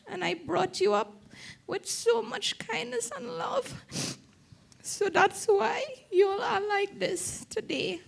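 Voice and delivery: quoting her mother through real tears, voice rising and cracking; a daughter weeping as she repeats her mother's words; tearful quote. Real, not acted. Quoting dying mother while weeping.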